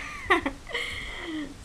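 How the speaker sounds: a bright burst of laughter at herself; amused laugh. Real, not acted. Laughing at her own mix-up with the concealer. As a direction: laugh it off, high and light.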